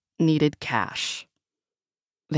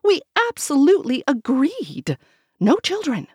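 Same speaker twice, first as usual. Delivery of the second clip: panicked; 10 semitones higher, 5 dB louder than usual. A narrator's reading.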